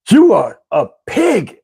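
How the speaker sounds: slow, high mock scolding; imitating an irate woman, word by word; going off. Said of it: "she starts going off on me".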